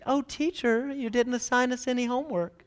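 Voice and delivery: lightly mocking goody-goody student; teacher's pet. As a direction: play the eager good student. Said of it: "students like my wife".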